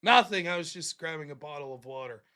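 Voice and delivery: low, soft, harmless excuse; meek backing off. Retold or imitated. Roommate excusing himself to knife-wielding woman, small meek voice.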